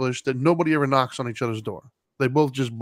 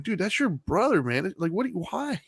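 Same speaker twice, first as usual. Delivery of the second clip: retold pleading, pitched up high; exasperated plea; 6 semitones higher, 3 dB quieter than usual. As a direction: plead with them in mock disbelief. Retold exasperated plea to squabbling kids.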